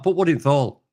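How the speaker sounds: Yorkshire dialect, THE HOLE run into one word; Yorkshire mum's saying. Retold or imitated. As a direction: quote mum in Yorkshire dialect. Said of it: "mum used to say that".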